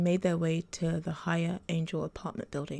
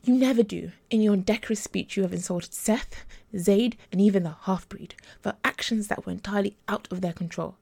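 loud, cutting reproach; sharp rebuke; 2 semitones higher, 6 dB louder than usual. Acted. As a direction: scold him hard for his careless words. Gabriella rebukes Matthias.

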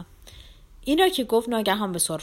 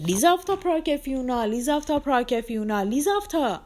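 higher-pitched cries of protest; raised voices calling; 6 semitones higher than usual. A narrator's reading. Everyone around calls out to Lizaveta, raised voices. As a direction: call the name out in alarm. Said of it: "از همه طرف صدا بلند شد".

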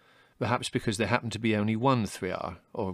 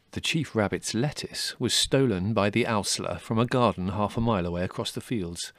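ordinary delivery, nothing marked

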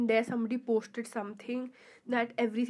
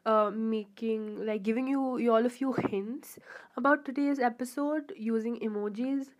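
ordinary talk, nothing marked

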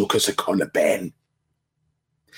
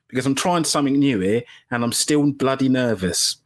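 ordinary talk, nothing marked

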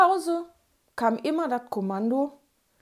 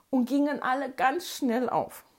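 plain reading voice

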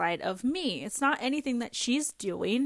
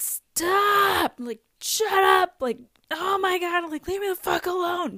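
high, loud mock shout of stop and shut up; angry yell; 9 semitones higher, 6 dB louder than usual. Mother snapping at her interrupting daughter, high loud yell. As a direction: snap at the kid like you've lost it. Mom losing temper at daughter.

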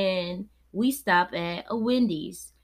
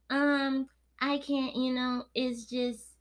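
mock hesitant cashier; 5 semitones higher, 5 dB quieter, slower than usual.

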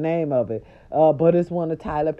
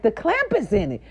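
rising to a high loud yell; retold angry yell at the nurse; retold scolding; 11 semitones higher, 5 dB louder than usual. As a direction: tell her off, building up. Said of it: "So I'm like".

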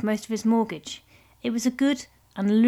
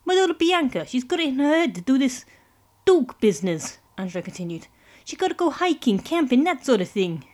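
higher, louder godfather voice; Italian mob boss; 4 semitones higher, 3 dB louder than usual. Acted. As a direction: play a smooth mafia don.

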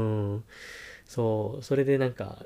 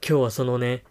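ordinary talk, nothing marked

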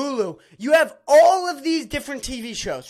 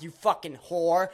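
ordinary delivery

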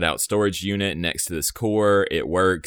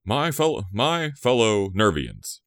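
mock presidential address; 4 semitones higher than usual.

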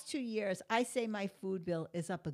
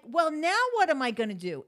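exasperated; 6 semitones higher, 8 dB louder than usual. Retold or imitated. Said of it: "Now I'm like".

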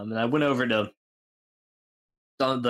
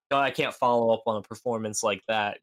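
usual speaking voice

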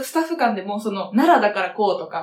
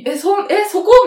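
high, louder bewildered double-take; mock startled reaction; 10 semitones higher, 6 dB louder, much slower than usual. Retelling a colleague's baffled comeback to a tease, mock startled. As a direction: stammer it out as if caught off guard, pitch jumping up.

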